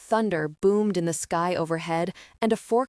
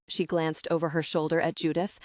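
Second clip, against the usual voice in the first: usual speaking voice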